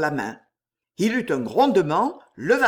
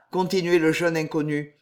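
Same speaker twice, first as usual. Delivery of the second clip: usual speaking voice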